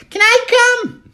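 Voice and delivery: high, loud falsetto wife, drawn out; nagging wife. Acted. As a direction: shriek it as the mock wife. Mimics wife asking to come.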